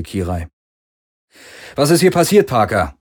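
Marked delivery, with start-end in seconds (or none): 1.8-3.0 higher, louder shout across the distance; calling out. A narrator's reading. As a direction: call out to him demanding answers. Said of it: "rief Galen".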